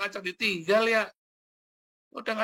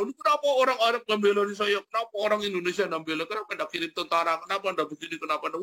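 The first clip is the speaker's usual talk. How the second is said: angry ranting; 8 semitones higher, faster than usual. Retold or imitated. Hamas complaining nobody defends them, mock angry rant. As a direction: complain indignantly to everyone. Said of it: "Wah, marah-marah".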